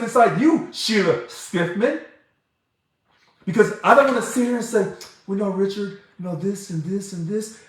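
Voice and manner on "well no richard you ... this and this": soft mock calm, mimicking a polite critic; hushed, sarcastic imitation of calm talk. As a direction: talk it down softly like a boss. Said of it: "If I want to see someone be calm".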